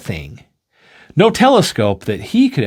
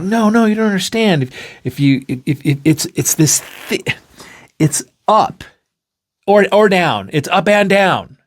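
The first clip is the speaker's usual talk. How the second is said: pleading explanation; 8 semitones higher than usual. Acted.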